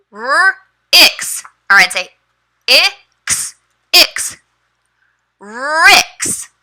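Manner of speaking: put-on high, bright letter sounding for a child; teacher voice. Shown as a demonstration. Teacher models blending letters for her student, high and bright. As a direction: sound out the letters slowly for a young learner. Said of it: "maybe I'd say".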